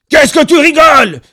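very high, very loud shriek; full-force screaming. A narrator's reading. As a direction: scream it with all your might. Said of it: "hurlait le barbu de toutes ses forces".

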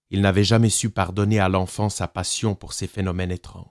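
usual speaking voice

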